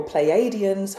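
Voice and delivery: "Pleiadians" with a long extra AY after "play"; play-AY-dee-ans pronunciation. Shown as a demonstration. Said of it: "however your accent wants to call it".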